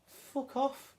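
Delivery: a broad Scottish "Fuck off." pitched high; Scottish accent on "Fuck off.". Shown as a demonstration. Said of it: "say it in a very Scottish accent".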